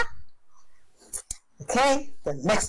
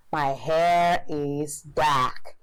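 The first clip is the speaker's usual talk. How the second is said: slow translation; 3 semitones lower, much slower than usual. Shown as a demonstration. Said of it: "slow translation".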